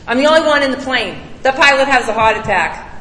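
ordinary delivery

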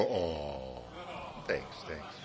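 low, soft mumbled "oh, thanks"; grudging low thank-you; mock thanks. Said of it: "everybody go".

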